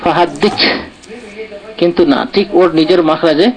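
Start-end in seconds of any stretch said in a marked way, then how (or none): none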